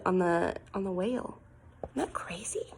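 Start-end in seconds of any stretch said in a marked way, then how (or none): 1.8-2.7 awed whisper. Acted. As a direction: whisper in wonder. Amazed aside to children.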